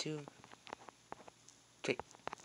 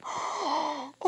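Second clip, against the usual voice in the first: sharp gasp; 11 semitones higher than usual. Real, not acted. Sudden shock.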